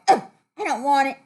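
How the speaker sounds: fast, loud mock-disgusted ugh; rude refusal. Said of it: "you don't go".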